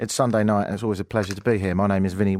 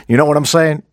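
loud, raised mock catchphrase; mimicking the rapper; 5 semitones higher, 10 dB louder than usual. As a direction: throw out the rapper's verbal tic loudly. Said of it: "it does make you sound very unconfident".

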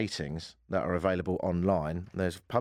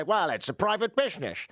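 mock New York accent; 10 semitones higher than usual. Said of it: "why you're from New York".

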